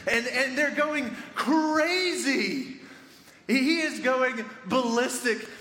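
high, stretched-out emphatic storytelling; exuberant, high-pitched retelling; wildly excited. Retold or imitated. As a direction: draw out the craziness with rising glee. Narrating the guard going crazy.

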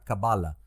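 "Kabbalah" stressed on the middle syllable, ka-BA-la; English pronunciation. Shown as a demonstration. Host showing how English speakers accent it, "Kabbalah" as ka-BA-la. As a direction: say "Kabbalah" English-style, punching BA. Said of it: "Fíjate cómo lo acentúan".

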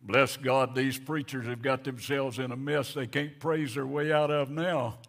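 loud mocking jab for others to overhear; a scornful loud remark across the room; said real loud. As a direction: say it loud so the young ones hear. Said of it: "said it real loud so these young charismatics could hear".

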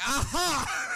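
spring the trap, triumphant; gotcha aha. Retold or imitated. Mom catches the lie.

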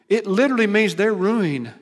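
preaching at full force. Acted. Preacher to the congregation about destroyed cities, booming. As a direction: drive home the ruin from the pulpit.

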